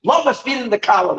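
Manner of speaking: high, chanting call; retold yell. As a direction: repeat the rapturous cry, pitched high. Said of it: "he yells".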